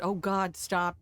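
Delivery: ordinary talk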